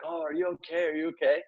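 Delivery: high, soft voice of concern; imitated concern. Patient checking on the rescuer who just yelled, high soft concern. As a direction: softly imitate a worried patient.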